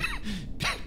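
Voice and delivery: bursting high laugh; real amused giggle. Real, not acted. Laughs at viewer comment.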